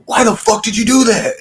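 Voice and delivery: the bloodied friend demanding why he was hit; bewildered angry friend. Retold or imitated. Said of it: "he's like".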